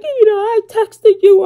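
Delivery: high, loud tearful whine mimicking her daughter; imitating crying teen. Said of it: "She starts crying".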